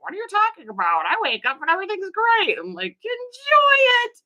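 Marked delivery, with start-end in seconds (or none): 0.0-2.6 mock young person, pitch leaping high; hammed-up incredulous youth; imitating young person. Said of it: "Anyone that's under the age of thirty". 3.0-4.2 high, loud, drawn-out mock retort; hammed-up, high-pitched send-off. Mock retort to carefree young people.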